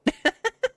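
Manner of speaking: high, delighted giggle; real delighted giggle; squealy giggle. Real, not acted. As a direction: let out a giddy squeal. Gushing over cute character.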